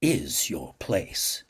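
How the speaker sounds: very slow, measured pronouncement; a hint of an old Cardassian patriarch; stern father figure. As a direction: lay it down like a final verdict. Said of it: "Lokar's voice informed me".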